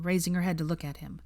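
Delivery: ordinary delivery, nothing marked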